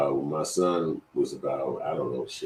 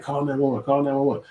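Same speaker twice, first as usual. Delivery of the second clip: urgent call for help; 8 semitones higher, 3 dB louder than usual. Retold or imitated. Urging wife to call help.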